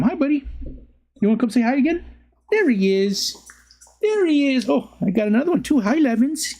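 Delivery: high cooing pet voice. Real, not acted. Owner greeting his dogs in high baby talk. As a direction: coo up high at the dog.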